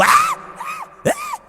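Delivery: very high-pitched mock shriek; thin squealing cries of fright, imitated; imitated screams. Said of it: "cuando oigo". (chillidos agudos de susto, imitados)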